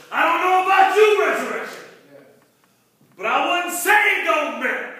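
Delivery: loud, high call to the congregation; full-force preaching. Real, not acted. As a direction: throw it out to the whole church.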